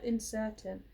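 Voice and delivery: ordinary delivery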